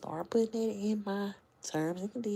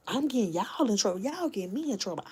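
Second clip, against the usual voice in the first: ordinary talk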